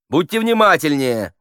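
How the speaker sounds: loud, high reprimand; exasperated director's call; scolding shout. A narrator's reading. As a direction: shout a sharp order to the actor. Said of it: "закричал Хуриев".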